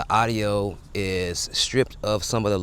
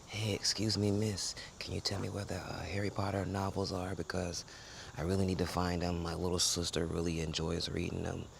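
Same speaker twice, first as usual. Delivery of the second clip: much softer, fading toward a breathy whisper at the end; library tone; 12 dB quieter than usual. Pretend. Asking a librarian for Harry Potter novels, softly. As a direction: hush it like a library. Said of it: "that's our library tone".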